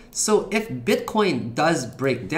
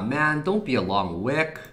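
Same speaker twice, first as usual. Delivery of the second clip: ordinary delivery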